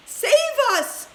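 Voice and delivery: high loud panicked plea; frightened old grandma crying out; terrified cry. A narrator's reading. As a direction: shriek for help in terror. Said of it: "cried Grandma Josephine".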